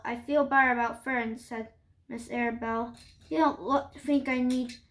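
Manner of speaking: usual speaking voice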